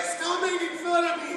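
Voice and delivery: very high mock-complaining voice; imitated girlfriend. Girlfriend protesting to actor who mimics her, high imitated voice. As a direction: squeal it as a teased girlfriend. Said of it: "She's like".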